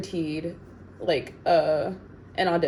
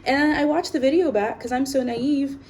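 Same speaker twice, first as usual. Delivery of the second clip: indignant; 7 semitones higher, 4 dB louder, faster than usual. Real, not acted. Upset by an agent's comment.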